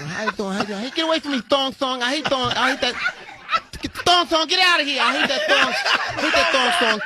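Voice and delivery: high, loud, agitated Mike Tyson rant; imitating Mike Tyson. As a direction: squeal it out like an irritated Mike Tyson shooing people off. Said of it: "he was like".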